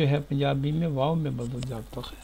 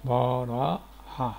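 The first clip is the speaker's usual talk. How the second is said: بارہا stretched very slow with a fall; very slow headword; much slower than usual. Shown as a demonstration. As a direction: draw بارہا out slowly, landing down. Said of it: "برخ".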